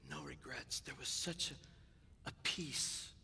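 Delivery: hushed, breathy whisper; whispered, moved, remembering his late mother. Real, not acted. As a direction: let it fall to a whisper of peace. Remembering mother's death, sermon climax.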